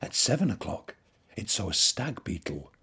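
ordinary delivery, nothing marked

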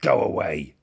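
loud grumpy shout. A narrator's reading. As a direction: bark it out crossly. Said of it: "shouted the bad-tempered ladybird".

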